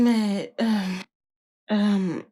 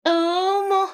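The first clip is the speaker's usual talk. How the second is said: put-on soothing baby talk; 7 semitones higher than usual. Nanny soothing crying baby.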